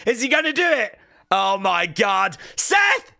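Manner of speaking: rising excitement. Real, not acted. Watching the finisher coming, getting excited. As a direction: build up and burst out with the name. Live call of the move.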